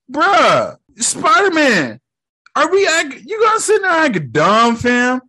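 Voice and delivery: high, exasperated outburst. Real, not acted. Movie fan berating Spider-Man for acting dumb, exasperated.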